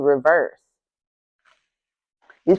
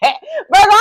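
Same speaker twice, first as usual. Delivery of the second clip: laugh shout; 12 semitones higher, 4 dB louder than usual. Real, not acted. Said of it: "Let me calm down".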